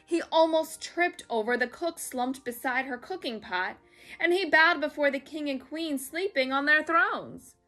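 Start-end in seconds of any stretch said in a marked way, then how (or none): none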